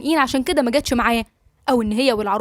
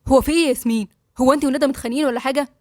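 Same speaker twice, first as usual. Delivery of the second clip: ordinary delivery, nothing marked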